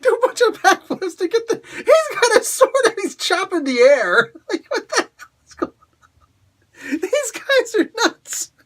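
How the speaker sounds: high voice breaking up with laughter, slowed; can barely get the words out for laughing; laughing hard. Real, not acted. Reviewer cracking up at a comic page, laughing through words.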